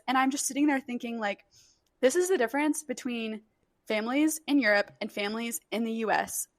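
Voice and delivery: ordinary talk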